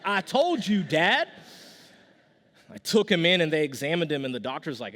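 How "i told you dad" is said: imitating an insistent kid, high and loud; mock-exasperated son, raised in pitch and volume; exasperated child. Son answering dad's repeated question, mock-exasperated.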